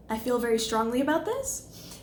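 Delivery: uptalk with "this" rising at the end; statement as question. Shown as a demonstration. Coach shows uncertain statements, question inflection. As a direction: undercut it with a questioning lift on "this". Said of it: "we turn these statements into questions".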